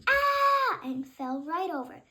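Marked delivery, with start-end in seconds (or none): 0.1-0.8 high loud shriek; high startled yelp; yelled scream. Acted. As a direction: scream and fall over. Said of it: "His father yelled".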